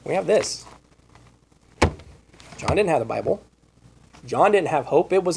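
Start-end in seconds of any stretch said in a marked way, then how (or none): none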